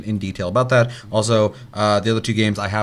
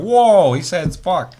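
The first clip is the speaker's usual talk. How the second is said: mock shock; 9 semitones higher, 7 dB louder, slower than usual. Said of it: "people are like".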